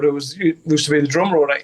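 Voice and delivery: normal talking voice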